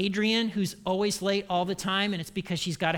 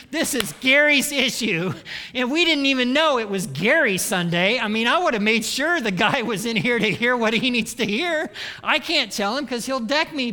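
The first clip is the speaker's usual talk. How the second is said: louder, gleeful self-righteous churchgoer; smug gossip; 3 semitones higher, 6 dB louder than usual. Retold or imitated. Churchgoer gleefully blames Gary during the sermon, louder. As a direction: gloat about someone else's sin. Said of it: "we've thought to ourselves".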